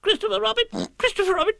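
very high excited squeal; frantic tiny animal call; crying-out Piglet. Acted. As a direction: squeak urgently for help. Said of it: "cried Piglet".

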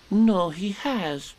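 light Piglet voice. Acted.

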